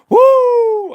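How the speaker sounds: high whooping shout; mocking whoop. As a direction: let out a mock woo. Mocking cheer at opponents.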